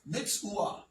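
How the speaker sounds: "Mitzvah" as mits-oo-WAH, no V; ancient Hebrew pronunciation. Shown as a demonstration. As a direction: turn the V of "Mitzvah" into a vowel glide. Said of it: "That's how you would say it".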